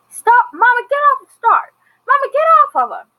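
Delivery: very high, loud, panicked shouting; an imitated desperate son yelling for it to stop; frantic pleading yell. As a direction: scream at mom to get off her, fast at the end. Noah trying to pull his mother off.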